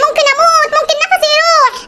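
playing the dying martyr for his wife; mock panic.

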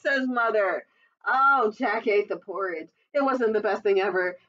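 ordinary delivery, nothing marked

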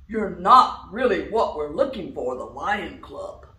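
loud, low lion refusal. Acted. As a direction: turn him down like a lion. Lion rejects duck.

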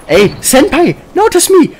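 very high, loud fangirl squeal; excited anime fan. Pretend.

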